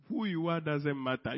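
ordinary talk

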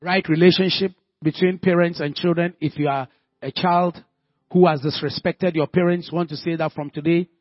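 loud, fervent preaching voice. Acted. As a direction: pray it out loud with full force. Prays aloud at full force.

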